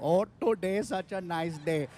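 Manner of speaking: put-on a mild cheerful Indian accent bobbing along; comic describing head wobble, soft Indian accent. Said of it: "With Indians, it's all the time".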